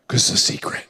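hushed, confiding whisper; secretive whisper. Acted. Playfully confiding why God keeps secrets.

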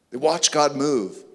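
usual speaking voice

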